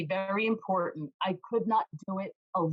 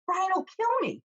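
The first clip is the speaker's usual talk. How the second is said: mock panic; 12 semitones higher, 3 dB louder than usual. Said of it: "And I'm like".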